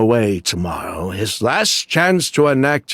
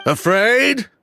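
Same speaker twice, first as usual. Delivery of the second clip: high incredulous scoff; 9 semitones higher than usual. Acted. Thief mocking Edgar's question with an incredulous scoff. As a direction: throw the word back, pitched up in disbelief. Scoffs at being called afraid.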